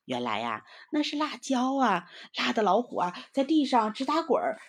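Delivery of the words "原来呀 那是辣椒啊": ordinary delivery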